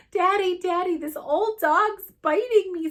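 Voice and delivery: high, loud wail for Daddy; screaming child. A narrator's reading. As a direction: yell like a panicky little boy tattling. Screaming boy pinned by dog.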